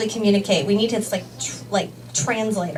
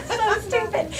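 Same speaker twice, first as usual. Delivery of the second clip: high, breaking with laughter; can barely get it out for laughing; laugh-crying; 12 semitones higher than usual. Real, not acted. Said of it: "laughing till crying".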